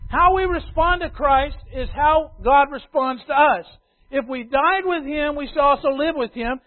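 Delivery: loud, high, rhythmic pulpit cadence; full-voiced preaching refrain; preaching at full force. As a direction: drive each line home to the church. Building the scriptural refrain.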